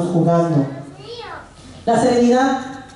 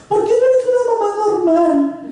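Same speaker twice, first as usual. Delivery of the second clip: put-on much higher, whiny teen voice; teen daughter; 10 semitones higher than usual. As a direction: mimic the daughter's complaint. Daughter questions mother. (imitando a la hija quejosa)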